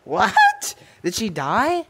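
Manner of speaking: high, loud startled yelp rising at the end; loud disbelieving squeal; shocked question. Real, not acted. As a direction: blurt it out in alarm, pitch shooting up. Startled that friend went silent.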